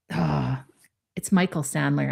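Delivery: ordinary delivery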